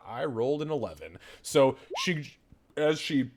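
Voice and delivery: ordinary delivery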